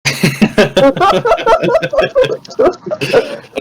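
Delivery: real laugh, loud and high. Real, not acted. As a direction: burst of high cackling. Table cracks up at insult.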